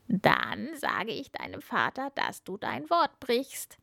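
frog voice with swooping pitch; threatening frog. A narrator's reading. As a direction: threaten to tattle, as a sulky frog. (drohender Frosch mit schwankender Stimme)

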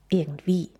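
usual speaking voice

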